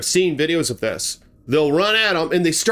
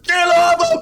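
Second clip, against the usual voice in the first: very high, loud battle shout; yelled get off; 5 dB louder than usual. Retold or imitated. Uncle charging the bear with a stick, very high loud shout. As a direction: bellow it as you charge in swinging. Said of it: "And he's like".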